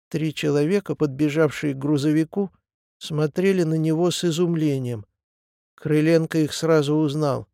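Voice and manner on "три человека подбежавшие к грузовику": ordinary delivery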